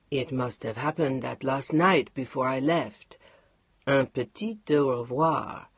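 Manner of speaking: low, unhurried husband with a teasing French goodbye; Mark's voice. Acted. Mark voiced in read story.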